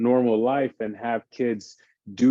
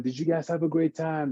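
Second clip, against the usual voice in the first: put-on asking kids; 5 semitones higher than usual. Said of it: "I get feedback from them".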